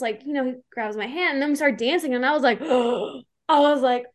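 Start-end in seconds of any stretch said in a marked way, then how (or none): 2.7-3.2 reenacted gasp of amazement; starstruck gasp. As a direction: suck in breath, awestruck. Reenacts her starstruck reaction.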